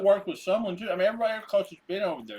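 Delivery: talking around ice; ice in mouth. Real, not acted. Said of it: "I have ice in my mouth".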